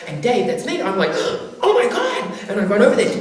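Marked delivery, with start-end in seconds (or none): 1.6-2.2 high laughing gasp of alarm; shocked. Real, not acted. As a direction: squeal it out in disbelief. Shocked at Dennis's outburst.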